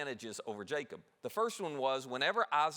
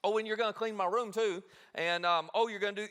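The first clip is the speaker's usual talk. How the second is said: rattling off demands; 5 semitones higher, 4 dB louder than usual. Retold or imitated. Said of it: "you just rattle".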